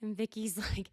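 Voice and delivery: ordinary talk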